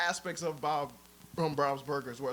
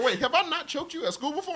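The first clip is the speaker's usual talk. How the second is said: higher, puzzled Homer asking; a hint of a clueless dad; lightly voiced Homer; 11 semitones higher than usual. Retelling Homer's question, higher and puzzled. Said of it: "he's like".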